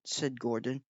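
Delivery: ordinary delivery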